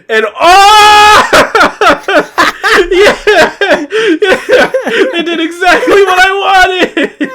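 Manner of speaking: high, loud joyful yelling; excited shouting. Real, not acted. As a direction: shout with laughing delight. Overjoyed reaction to trailer news.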